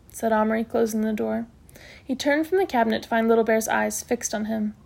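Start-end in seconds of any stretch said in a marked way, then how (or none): none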